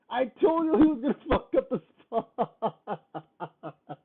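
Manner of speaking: loud, slow laughing gloat. Real, not acted. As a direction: laugh through the told-you-so. Gloating while laughing at player.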